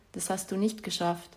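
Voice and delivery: usual speaking voice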